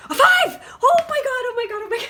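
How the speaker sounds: high, loud shriek with laughter; shrieking jackpot winner. Real, not acted. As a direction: squeal like winning the lottery. Same card drawn again, shrieking.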